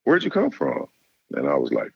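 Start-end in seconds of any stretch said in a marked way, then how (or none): 0.0-0.9 high-pitched mock coach voice, friendly; imitating the coach. Coach phoning a recruit late at night, high mock coach voice. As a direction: put on a much higher voice to play the coach calling late. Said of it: "He said".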